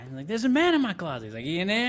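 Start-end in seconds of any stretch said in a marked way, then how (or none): 0.3-1.3 put-on high, scared little boy; scared kid voice. Kid telling Major Payne about the closet, high and scared. Retelling movie kid scene. 1.4-1.9 mock tough marine. Retelling Major Payne scene.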